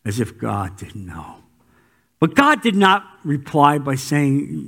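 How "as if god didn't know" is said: low aside. Real, not acted.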